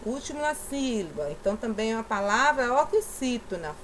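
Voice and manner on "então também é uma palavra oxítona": usual speaking voice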